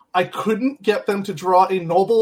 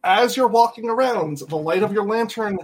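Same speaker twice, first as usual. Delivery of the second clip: slow story narration; slower than usual. A narrator's reading. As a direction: narrate it slowly, unhurried. GM narrates slowly.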